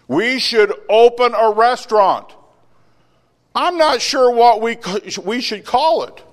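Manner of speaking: high, loud chicken calling to the pig; a squawky farmyard chicken, hammed up. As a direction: play the eager chicken. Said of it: "Hey, Pig".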